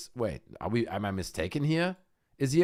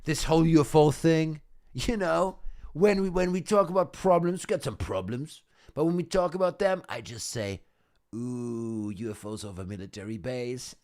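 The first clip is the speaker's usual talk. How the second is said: mock Rubio schmoozing. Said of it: "He's like".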